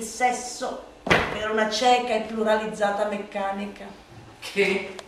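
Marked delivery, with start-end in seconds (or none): none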